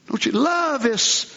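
ordinary talk

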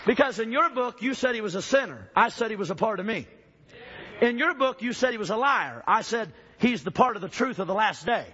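rising fiery preacher cadence; preaching. Acted. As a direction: build it up like a revival preacher. Preaching at full force.

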